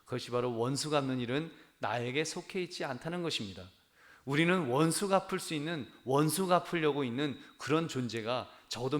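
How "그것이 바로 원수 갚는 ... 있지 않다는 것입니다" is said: ordinary talk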